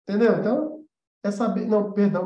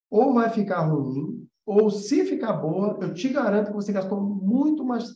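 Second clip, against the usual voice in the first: slow, deliberate warning; slower than usual. Real, not acted. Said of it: "Isso eu posso garantir pra vocês".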